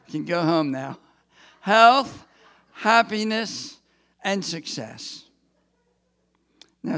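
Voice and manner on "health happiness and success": loud, ringing announcement; proclaiming loudly. Real, not acted. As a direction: call out the wishes. Proclaiming the three wishes.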